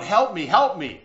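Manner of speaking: loud mock cry for help; retold yell for help. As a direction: yell it out like a trapped man in a retold story. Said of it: "the man yells out".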